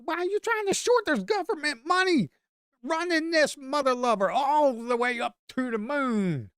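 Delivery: squeaky exasperated taunting; mock incredulous rant. Real, not acted. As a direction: scold the short seller in disbelief. Mocking a short seller.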